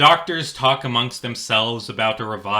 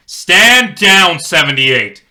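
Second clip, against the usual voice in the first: loud, higher order to stop; commanding shout; 7 semitones higher, 12 dB louder, slower than usual. Acted. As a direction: halt the fight with authority. Captain commands the cop.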